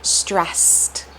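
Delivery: "stressed" said slowly with emphasis on the s sounds; isolated, carefully weighted "stressed"; stressed word. Shown as a demonstration. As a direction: lean on the S's in "stressed". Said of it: "the way that I just said".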